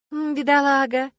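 sarcastic drawn-out sympathy; teasing fake pity for the poor guy; snide. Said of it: "ехидно вставила я".

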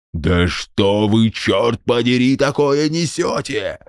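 put-on slow, baffled old soldier; baffled general. A narrator's reading. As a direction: puzzle it out like a stunned general. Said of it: "продолжал недоумевать генерал".

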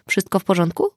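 ordinary delivery

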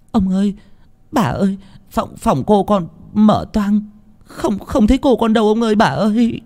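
high, stammering frightened report; panicked maid. Acted. Maid reporting the young mistress missing, panicked. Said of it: "mặt tái mét".

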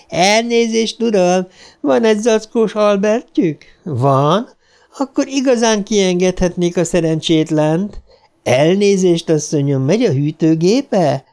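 high, giggling prank-caller clown. A narrator's reading. Clown mocking Ben from the stairs, giggling. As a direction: cackle through the silly jokes. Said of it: "kiabált le krajcáros vihogva".

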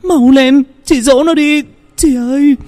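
very high, tearful snapping plea; snapping, near tears. Acted. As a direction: snap at her sister while about to cry. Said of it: "gắt lên, giọng cũng như muốn khóc".

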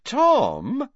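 put-on high girl's call; a young woman calling out, pitched high. A narrator's reading. Girl calls to her riding companion, high-voiced. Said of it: "said the girl's voice again".

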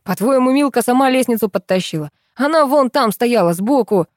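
high, exasperated argument; indignant, worked-up holler; angry yell. A narrator's reading. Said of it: "заорал Фёдор".

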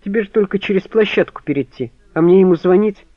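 high, loud indignant protest. A narrator's reading. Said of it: "возмутился он".

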